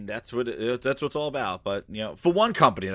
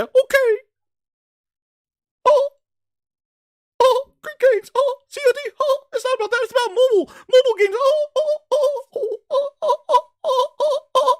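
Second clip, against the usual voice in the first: squealing Phil. Pretend. Mock Phil, squealing again.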